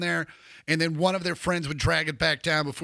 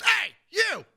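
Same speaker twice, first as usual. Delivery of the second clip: put-on [name] calling out; 13 semitones higher than usual. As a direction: hail him like an excited rocker spotting a fan. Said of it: "he goes".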